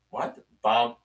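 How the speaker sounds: ordinary delivery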